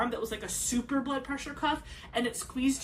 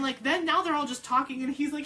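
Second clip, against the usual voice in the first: usual speaking voice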